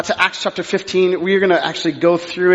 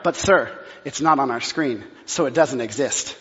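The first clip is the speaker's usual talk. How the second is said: lower, softer mock-robotic clerk; deadpan staff; 5 semitones lower, 6 dB quieter than usual. Staff telling pastor he doesn't exist, low deadpan. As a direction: drone it like an unbending clerk. Staff refusing him entry.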